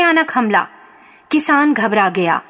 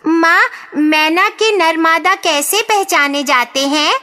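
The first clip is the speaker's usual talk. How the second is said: put-on higher-pitched little boy's question; curious child; 6 semitones higher than usual. A narrator's reading. Young son asks his mother about the mynas, curious. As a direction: ask in a raised, childlike voice.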